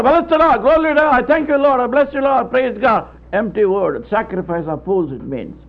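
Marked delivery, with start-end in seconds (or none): 0.0-3.1 higher and louder hollow praise; loud, high, exaggerated hallelujah crowd; mock church shouting. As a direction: ham up the empty shouting worshippers. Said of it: "everybody is shouting".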